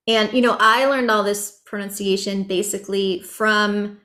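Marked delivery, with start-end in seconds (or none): none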